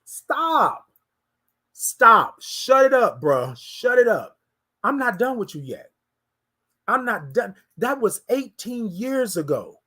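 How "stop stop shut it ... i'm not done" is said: God scolding a despairing man; God's stern rebuke. Retold or imitated. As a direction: shut the pleading down hard. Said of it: "He said".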